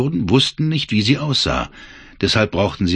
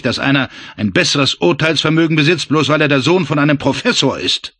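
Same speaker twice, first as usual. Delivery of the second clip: Weber sneers at the arrogant major; a jeering, needling voice, slightly raised; sneering taunt; 6 semitones higher, 3 dB louder than usual. Acted. Weber mocks Frank as a professor's son, sneering.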